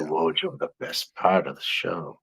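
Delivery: imitating a friend's accent, low and soft; Sam's accent. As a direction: softly mimic a friend's accent. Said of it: "I can hear Sam's accent, like, talking like that".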